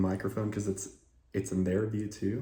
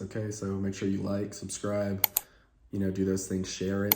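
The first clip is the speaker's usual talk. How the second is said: normal talking voice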